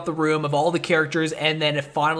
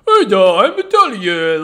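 loud, higher-pitched mock Italian accent; terrible Italian accent; 5 semitones higher, 10 dB louder than usual. Host mimicking Brad Pitt's character failing to pass as Italian, terrible Italian accent. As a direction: ham up a hopelessly bad Italian accent. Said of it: "has the worst Italian accent".

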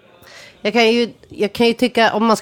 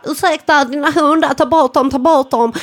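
mock Skåne accent; 6 semitones higher than usual. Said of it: "jag kan inte skånska".

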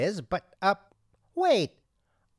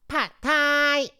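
involuntary shout of Pad Thai; sudden outburst; 11 semitones higher, 5 dB louder than usual. Acted. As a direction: blurt Pad Thai much higher and louder. Repeats the outburst.

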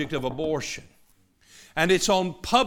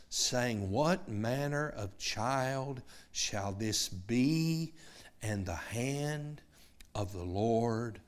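slow, soft, solemn Bible reading; scripture reading; 4 semitones lower, 5 dB quieter, slower than usual. Acted. Preacher reading Luke to the congregation, slow and soft. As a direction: read it slowly and softly to the listeners.

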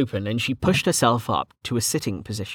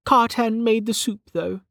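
high-pitched character voice, matter-of-fact; Velika's statement; 11 semitones higher than usual. Acted. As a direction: state it in a much higher voice.

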